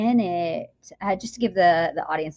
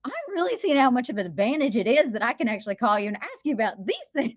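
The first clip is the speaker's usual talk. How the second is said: excited best friend; 6 semitones higher than usual. Retold or imitated. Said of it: "She goes".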